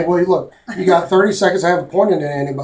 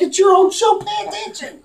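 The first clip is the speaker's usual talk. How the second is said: mock scolding; 14 semitones higher than usual. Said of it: "I'm like".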